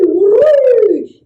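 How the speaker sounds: loud high whooshing whee; high whee of fire. A narrator's reading. Firestorm roaring through the village.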